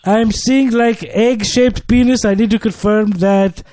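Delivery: put-on call-center agent in a lightly done African accent; mock African accent, slightly high. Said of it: "I couldn't do my African accent".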